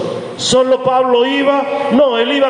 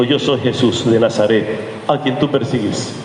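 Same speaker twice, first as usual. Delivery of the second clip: voice of Jesús; 9 semitones lower than usual. Acted. Jesús speaks to Pablo from the light, low and solemn. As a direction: answer as the Lord, low and grave. Jesus answers Paul in retelling.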